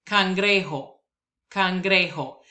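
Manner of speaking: cangrejo as kan-GRE-ho, j like h; cangrejo, stress on GRE; Spanish word. Shown as a demonstration. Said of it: "words that I will pronounce".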